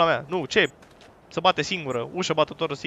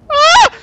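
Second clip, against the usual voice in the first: very high, loud shriek; short piercing yelp of fright; startled scream; 15 dB louder than usual. Real, not acted. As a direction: scream at a sudden scare. Startled by horror game.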